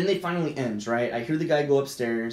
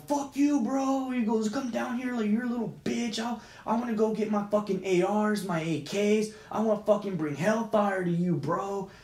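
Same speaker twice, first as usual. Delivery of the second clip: retold angry threats; 6 semitones higher than usual. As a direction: imitate an irate neighbor making threats.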